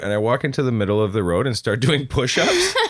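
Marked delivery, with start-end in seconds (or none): none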